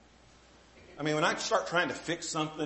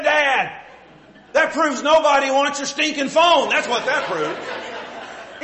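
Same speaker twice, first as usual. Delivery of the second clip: teasing son; 8 semitones higher, 10 dB louder, much slower than usual. Retold or imitated. Son mocking dad's phone.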